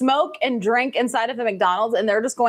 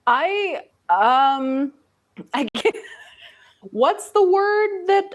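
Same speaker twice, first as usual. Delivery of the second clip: laughing while talking; 6 semitones higher, slower than usual. Real, not acted. Laughing while searching for a word.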